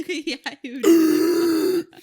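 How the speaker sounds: words squeezed out through high laughter; laughing. Real, not acted. Co-host cracking up at the ice bath joke, laughing through the words. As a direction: laugh hard while agreeing.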